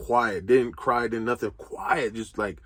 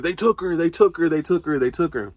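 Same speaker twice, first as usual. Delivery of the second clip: higher, louder, repeated in distress; desperate repeated plea, fading at the end; retold sobbing cry; 7 semitones higher, 4 dB louder than usual. Father telling Travis his daughter was taken, distressed higher voice. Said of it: "I'm crying to the point they can't understand me".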